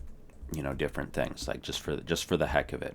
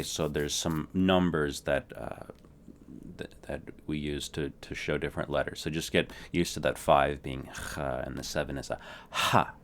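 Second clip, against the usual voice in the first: ordinary delivery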